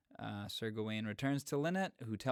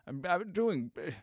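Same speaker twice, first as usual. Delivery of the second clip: fast, raised Scottish Connery voice; Sean Connery; 5 semitones higher, 5 dB louder, faster than usual. Acted. Podcaster plays Connery complaining of hard work, loud Connery accent. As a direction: grumble as Connery, slurring every s. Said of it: "you're very good at the Connery".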